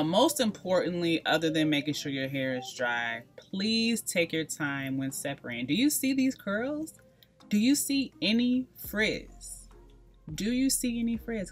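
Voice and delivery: usual speaking voice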